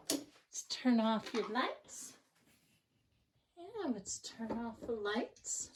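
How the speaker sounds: slow and soft bedtime voice; hushed. Real, not acted. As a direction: ease into a quiet lights-out. Turning off lights at bedtime.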